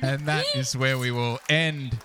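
laughing start, then drawn-out flourish; theatrical sign-off. Real, not acted. Game master closing the episode, laughing theatrical sign-off.

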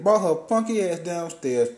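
ordinary talk, nothing marked

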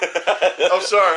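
loud, drawn out through real laughter; laughing apology. Real, not acted. Podcaster breaks up at Batman joke, laughing. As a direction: apologise while cracking up.

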